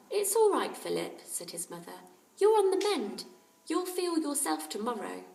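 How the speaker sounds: kindly mother. A narrator's reading. Aunt Ally checking on the sick boys, caring. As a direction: fuss over the sick boys.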